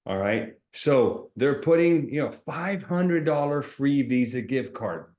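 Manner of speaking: ordinary talk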